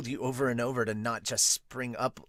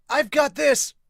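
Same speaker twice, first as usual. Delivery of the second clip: high, loud call for the ball; sudden loud cry of I've got this; character shout; 13 semitones higher, 9 dB louder than usual. Acted. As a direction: claim the ball at full voice. Player calls the ball.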